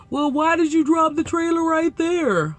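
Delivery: high, mocking imitation of the driver; put-on driver voice. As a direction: question it in his silly high voice. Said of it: "using that voice for his voice".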